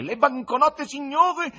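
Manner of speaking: high, loud bleating tenor; mock the presenter in a goat-like tenor; goatish tenor. A narrator's reading. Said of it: "alta voce tenorile da caprone".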